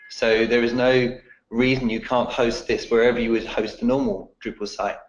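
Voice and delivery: usual speaking voice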